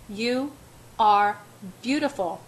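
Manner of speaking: "are" punched hard and loud, each word set apart; emphatic. A narrator's reading. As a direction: lean on "are", pause between words. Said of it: "his tone emphatic".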